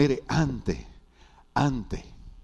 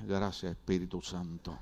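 relieved hush; 8 semitones lower, 13 dB quieter than usual. Retold or imitated. Relieved private thanks.

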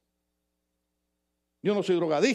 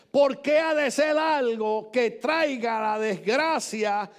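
preaching at full force; 4 semitones higher, much slower than usual. Acted. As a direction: plead with the king slowly. Preaching the scripture quote. (predicando con fuerza)

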